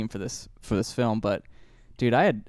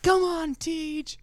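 much higher, shrill-pitched cheering mom call; cheering sports mom; 3 dB quieter, slower than usual. Retold or imitated. Mom in the stands cheering her son, much higher. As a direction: shout encouragement from the stands. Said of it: "his mom was always in the stands going like".

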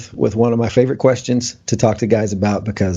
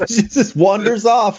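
amused; 9 semitones higher than usual. Real, not acted. Amused retelling.

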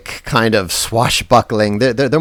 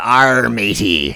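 put-on pirate accent; much slower than usual. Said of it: "there's a generic accent that pirates have".

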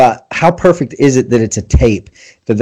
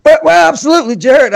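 put-on high old southern storyteller voice, much higher; Will Willimon imitation. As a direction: do the old southern storyteller with a Winnie the Pooh sound. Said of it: "he didn't say that, but in my head".

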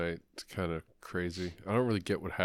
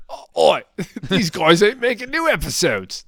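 loud, much higher mock Cockney; mock Cockney accent; 11 semitones higher, 13 dB louder than usual. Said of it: "I don't know if he talks like that".

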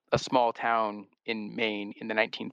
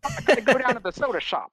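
put-on high, bright, louder fifties kid voice; 1950s gee-whiz voice; 13 semitones higher, 4 dB louder, faster than usual. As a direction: chirp it like a cheery soda-shop teen. Joking fifties character voices.

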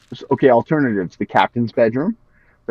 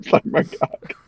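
whispered crude aside; breathy whisper punchline; 6 dB quieter, slower than usual. Pretend.

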